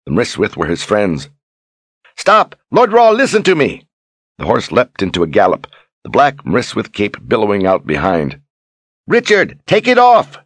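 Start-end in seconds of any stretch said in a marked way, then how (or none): 2.2-3.9 high, loud cry after a rider; desperate shout. Acted. Berdine shouting after Richard on his horse, high and loud. 9.1-10.4 high, loud cry after a rider; desperate shout. Acted. Berdine shouting after Richard on his horse, high and loud.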